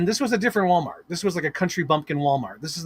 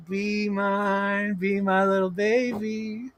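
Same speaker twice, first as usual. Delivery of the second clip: 1 soft coo; 4 semitones higher, 5 dB quieter, much slower than usual. Pretend. Repeating the coo, softly.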